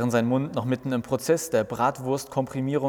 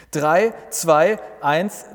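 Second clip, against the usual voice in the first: countdown; 5 semitones higher, 5 dB louder, much slower than usual. Acted. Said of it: "zählt Jesus runter".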